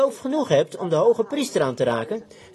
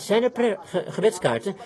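ordinary talk

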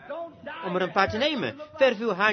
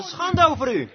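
high, loud condemnation; preacher's sudden rebuke; rebuking shout; 8 semitones higher, 5 dB louder than usual. Real, not acted. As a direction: hurl the shame at them.

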